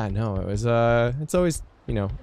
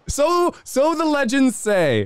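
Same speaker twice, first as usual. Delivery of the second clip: high, slow, ominous legend voice; grand storyteller voice; 4 dB louder, much slower than usual. Pretend. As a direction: intone it like an old legend. Mock-epic remark on rumor.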